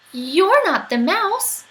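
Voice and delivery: put-on drawn-out curious child; puzzled child. Child questions owl.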